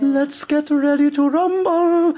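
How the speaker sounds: drawn-out ring announcer call. Shown as a demonstration. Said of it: "I'd love to hear [name]".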